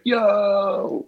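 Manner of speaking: high, drawn-out hail; imitated excited greeting. [name] answering his FaceTime call, greeting with high-pitched delight.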